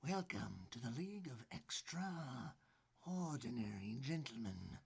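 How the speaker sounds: slow, stately announcer read; slow announcer intro. Shown as a demonstration. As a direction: announce it slowly and grandly. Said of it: "It's a little bit different than last time".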